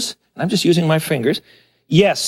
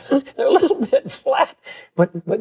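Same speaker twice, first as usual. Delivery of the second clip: laughing through the words, pitch jumping up; 7 semitones higher than usual. Real, not acted. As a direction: chuckle as you call them flat. Laughing while describing Welsh cakes.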